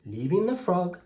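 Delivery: plain reading voice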